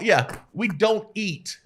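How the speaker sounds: slow, high "don't" drawn out; emphatic insistence. Real, not acted. To people blaming the podcast, slow high insistence. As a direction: lean on "don't" slowly.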